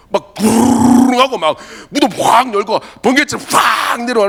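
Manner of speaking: a hammed-up roar of an entrance; dramatic mock arrival. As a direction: ham up the noisy entrance. Mocking the angel's dramatic arrival.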